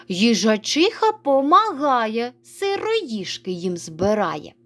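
slow, deliberate reading; slow and careful. Shown as a demonstration. Teacher to pupils, slow first reading. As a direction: draw each word out. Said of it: "спочатку повільно прочитайте".

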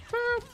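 very high, loud little boop; playful boop. Real, not acted. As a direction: squeak out a short, loud boop. Playful noise while shooting in game.

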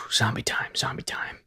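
breathy whispered chant. Acted. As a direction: whisper it like a creepy secret. Whispered zombie line.

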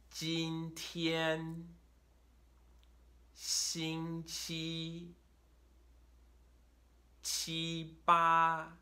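今天, 星期, 七八, 安斌, 温馨 all sung-out high and level; slow tone drill, 今天 to 温馨 on one high level pitch; first tone pairs. Shown as a demonstration. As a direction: hold both syllables high and flat in 今天, 星期, 七八, 安斌, 温馨. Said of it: "five words that are first tone, first tone".

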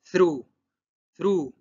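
"through" as THROO, tongue between teeth; "through" in one crisp syllable, silent gh; th pronunciation demo. Shown as a demonstration. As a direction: model "through" firmly, th then roo. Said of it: "T H entre los dien-, lengua entre los dientes".

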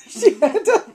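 high voice breaking up with laughter; laughing while talking. Real, not acted. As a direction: try to get the words out through real giggles.

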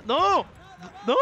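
sudden high shout of alarm; grenade warning yell. Real, not acted. Grenade bounces back at him.

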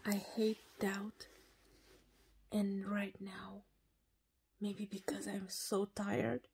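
tired whisper from bed at night. Real, not acted. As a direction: whisper it, almost no voice, already in bed. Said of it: "I don't know why I'm whis-, whispering".

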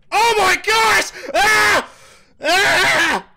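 high, loud slow shriek; terrified scream. Real, not acted. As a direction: scream in real terror. Jump scare by ghost.

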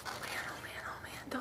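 breathless whispered awe; hushed excited whisper. Real, not acted. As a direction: whisper it like you can't believe it. Overwhelmed by cute earrings.

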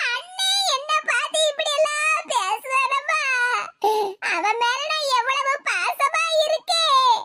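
slow, tearful, drawn-out lament; hammed-up hurt sister crying; theatrical wailing. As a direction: sob out each word as if heartbroken. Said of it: "கதறி கதறி அழுதான்னு".

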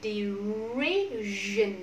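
"Derision" stretched, long r and long vowel; slow modelling. Shown as a demonstration. Teacher modelling for the class, a slow stretched "Derision". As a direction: draw out "Derision" syllable by syllable.

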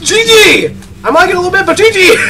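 excited shout. Real, not acted. Celebrating a win.

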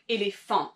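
ÉLÉPHANT in French, weight on the last syllable; French final-syllable stress. Shown as a demonstration. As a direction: demonstrate éléphant with a long final nasal -phant. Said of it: "putting the emphasis on that last syllable".

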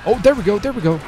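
pumped up as the bike lifts; excited cheer. Real, not acted. Gamer cheering his own wheelie, excited.

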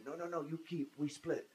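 lightly imitated Russian broken English; a brief echo of halting Russian English. Recalling the Russian accomplice's offer, light broken English. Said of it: "The guy who said".

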